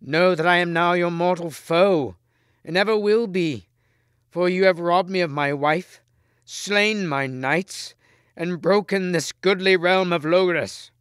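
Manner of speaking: betrayed king declaring Lancelot his mortal foe; furious king. A narrator's reading. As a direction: hurl his enmity at him. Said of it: "cried the king".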